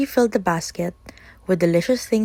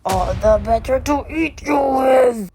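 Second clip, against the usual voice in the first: loud, higher roar; roaring wolf; 5 semitones higher, 4 dB louder than usual. Acted. Wolf leaping out of bed, roaring. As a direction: roar it as you pounce. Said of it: "the wolf roared".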